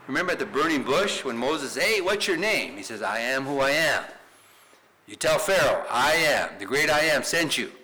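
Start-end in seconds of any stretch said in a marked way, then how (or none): 1.8-2.8 loud, higher-pitched question; Moses calling out. Acted. Acting Moses at burning bush. 3.0-7.7 loud, emphatic proclamation; God's bold declaration. Acted. Voicing God's declaration to Moses.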